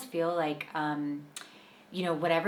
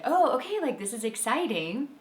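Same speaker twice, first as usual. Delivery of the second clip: happy realization; 7 semitones higher than usual. Retold or imitated. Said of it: "he said".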